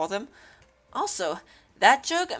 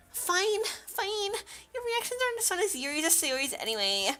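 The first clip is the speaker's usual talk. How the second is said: put-on high, light girlish character voice; high-pitched huffy dismissal; sulky game character; 9 semitones higher, 4 dB quieter than usual. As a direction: pout it in a high quick voice.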